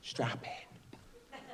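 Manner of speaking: hushed whisper; whispered warning. Real, not acted. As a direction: lean in and whisper it. Hushed setup to the story.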